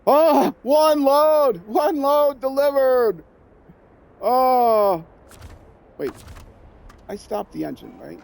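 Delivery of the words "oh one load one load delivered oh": high, loud drawn-out cheer; triumphant shout. Real, not acted. Player celebrating one load delivered, triumphant shout.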